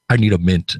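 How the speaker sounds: heavy breath, then a low villain line; deep, imposing movie villain imitation; Darth Vader. Podcaster quoting a favorite skit line, low villain voice. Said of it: "Darth Vader said".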